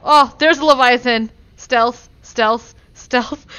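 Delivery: loud, urgent calling out; loud, thrilled warning; excited shout. Real, not acted. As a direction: yell the alert to a friend. Excited alert to friend Stealth.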